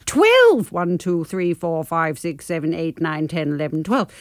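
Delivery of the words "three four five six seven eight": ordinary delivery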